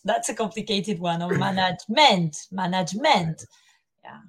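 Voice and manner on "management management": "management" as "man-adge-MENT"; "management" with the last syllable heavy and clearly pronounced; imitated mispronunciation. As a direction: copy a learner leaning on a full "MENT" at the end of "management". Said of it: "to copy it".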